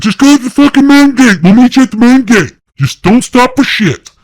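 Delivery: very high, loud barked commands; retold yelling, high and loud; furious shouted orders. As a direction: yell the orders across to the other truck. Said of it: "Jeremiah's like".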